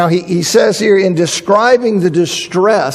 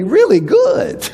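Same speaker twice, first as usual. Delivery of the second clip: higher, slow REALLY good; stretched emphasis; 10 semitones higher, slower than usual. Real, not acted. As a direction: drag out really good to stress it. Emphasizing how good the king was.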